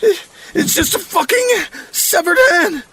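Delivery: very high, loud, near-crying rant; agonized indignation. Acted. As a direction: rail through agony, breaking into cries of pain. Bleeding, indignant, in agony.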